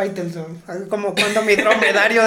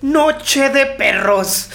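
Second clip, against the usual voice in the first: slow, drawn-out announcer voice; hammed-up TV commentator line; dramatic announcer; 5 semitones higher, 4 dB louder, much slower than usual. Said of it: "Faitelson dijo".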